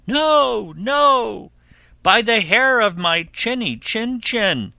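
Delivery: high, loud piglet refusal; defiant little pig. Acted. Pig refuses to let wolf in, high defiance.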